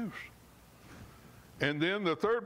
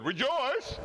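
much higher, bright sung-out greeting; cheerful greeting; 10 semitones higher than usual. Shown as a demonstration. Preacher demonstrating rejoice as a greeting, high and bright. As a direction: call it out like a happy hello. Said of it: "instead of saying hello".

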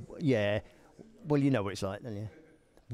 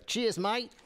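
put-on a light, higher-pitched stab at an Australian accent; mock Australian accent; 6 semitones higher than usual. Greeting viewers in Tasmania, light mock Australian accent. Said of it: "if that's how they talk over there".

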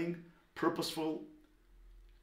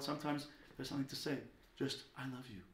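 much softer, intimate aside; hushed and gentle; tender whisper; 11 dB quieter, faster than usual. Acted. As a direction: confide it lovingly in a child's ear. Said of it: "I give them a whisper in their ear".

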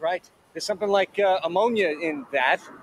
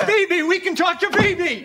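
high, eager exclamation; high-pitched, overeager cheer; excited greeting; 10 semitones higher, 4 dB louder, slower than usual. Acted. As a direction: pounce on the arriving friend with delight. Relieved to greet a friend.

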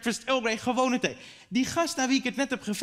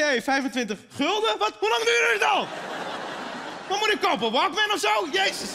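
retold disbelief; 8 semitones higher than usual. To coworkers over the voucher, laughing mock shock. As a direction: gasp at the old gift voucher, laughing. His own stunned reaction, retold.

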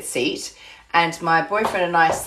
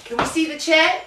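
much higher, lilting up to the audience; checking the crowd can see the chair; high checking question; 9 semitones higher than usual. Real, not acted. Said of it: "can you see that on the chair?".

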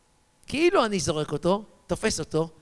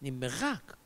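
normal talking voice

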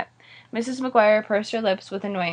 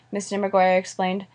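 usual speaking voice

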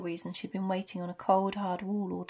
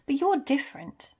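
higher, louder, emphatic admiration; exasperated praise; half exasperated, half admiring; 7 semitones higher, 5 dB louder than usual. A narrator's reading. McGonagall singles out Dumbledore, half admiring. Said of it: "half, half admiring".

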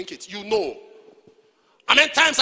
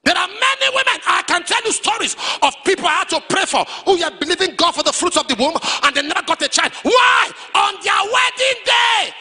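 high, loud, rolling shout; full-force preaching; 8 semitones higher, 6 dB louder than usual. Real, not acted. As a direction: build the testimony at full cry.

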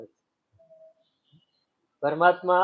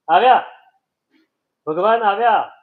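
excited cry; 2 semitones higher, 8 dB louder than usual. Acted. Old man hears the chariot.

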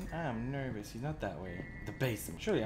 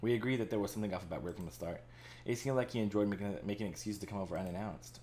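story narration; 2 semitones lower, 5 dB quieter than usual. Acted. Reads game narration.

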